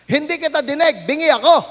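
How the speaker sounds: put-on loud old man yelling; deaf man shouting. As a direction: shout it like a man who can't hear himself. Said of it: "kung ba't siya malakas ang boses".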